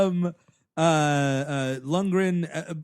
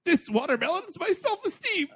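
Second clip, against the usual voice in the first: mock tragic. Playing Gallagher, mock tragic.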